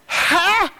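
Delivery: high startled Hæ; surprised. Retold or imitated. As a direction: blurt it out in disbelief. Stunned reply to preacher.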